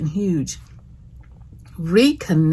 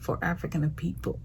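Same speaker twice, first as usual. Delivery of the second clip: "African" slips into an odd accent, with an extra syllable before "people"; unplanned odd accent; 10 dB quieter, faster than usual. Real, not acted. Host declaring love for African people, accidental odd accent. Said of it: "I don't know what accent that was".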